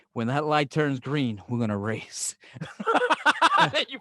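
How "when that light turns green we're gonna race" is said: slow, low, deadpan race dare; mock challenge. Friend suggesting a comeback to the driver, mock challenge. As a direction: lay down a joke challenge, low and deliberate.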